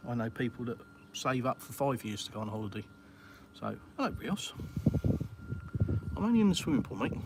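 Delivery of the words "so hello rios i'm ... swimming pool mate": pet talk. Real, not acted. Chatting from the pool to Rios, high pet-talk greeting. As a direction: coo a hello up at Rios.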